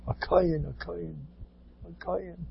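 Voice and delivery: fading to near silence in reverence; awed, dying-away repetition; hushed awe. Acted.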